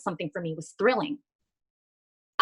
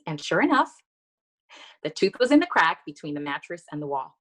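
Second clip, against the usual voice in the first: normal talking voice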